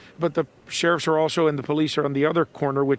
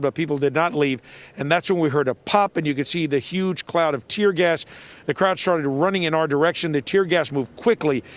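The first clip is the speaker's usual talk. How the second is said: ordinary delivery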